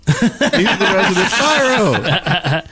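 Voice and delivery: slow, laughing through the words. Real, not acted. Cohost introducing the resident pyro player while laughing. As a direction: chuckle it out slowly.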